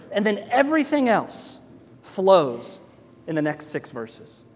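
ordinary delivery

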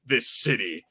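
hammed-up gritty rogue cop monologue, slowing down, laughing through it; mock noir cop. Joking about a fired guard turning rogue cop, mock noir narration. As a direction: narrate like a brooding detective in a cheap film noir. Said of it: "and go".

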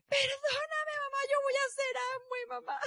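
put-on high, wailing plea like a little girl; retold sobbing plea. Daughter hugs her mother behind the curtain, high tearful plea. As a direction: beg forgiveness through tears. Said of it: "me abraza como niña chiquita sollozando".

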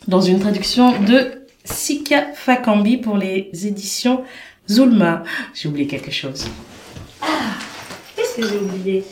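ordinary talk, nothing marked

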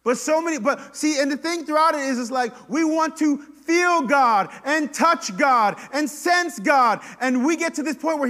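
loud, high, impassioned sermon voice; full-force preaching. Real, not acted. Preacher on wanting to feel God, loud and high.

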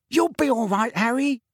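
bright, high encouragement; high reassuring voice. A narrator's reading. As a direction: reassure him warmly. Tonks reassuring Harry.

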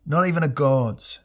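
loud, high defiant retort; desperate humorous shout. A narrator's reading. Elric shouts back at the pursuing god, desperate humour. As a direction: fling it back over your shoulder. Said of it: "Elric shouted back in desperate humour".

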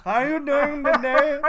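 put-on high, loud, slow Indian accent; Indian uncle impression. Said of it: "an uncle that's from India".

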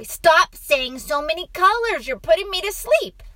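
put-on high, loud child's complaint; protesting boy. A narrator's reading. Boy complains to father about colours, protesting. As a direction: complain loudly in a little boy's pitch.

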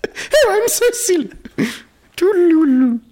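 high, loud prissy sing-song; mock a dainty twit skipping away; hammed-up high prissy voice mocking a friend; mincing dainty voice.

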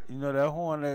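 ordinary talk

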